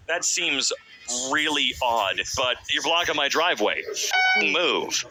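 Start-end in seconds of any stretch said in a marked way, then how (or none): none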